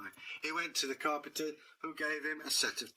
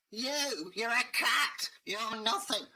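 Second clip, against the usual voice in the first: high, louder dismissive scoff; scoffing master; 10 semitones higher, 6 dB louder, slower than usual. Acted. Village master mocking Dave as nothing, high and loud. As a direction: sneer at the little cat.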